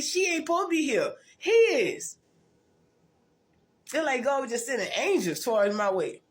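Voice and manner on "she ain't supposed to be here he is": excited high retelling. Retold or imitated. Psychiatrist quoted, voice pitched up.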